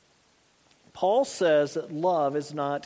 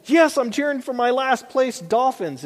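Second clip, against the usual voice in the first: loud, higher-pitched fan cheer; mock cheering; 6 semitones higher, 6 dB louder than usual. Preacher imitating a fan of a last-place team, mock cheering. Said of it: "You don't go".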